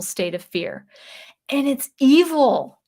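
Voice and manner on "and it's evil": indignant. Real, not acted. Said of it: "indignant emphasis".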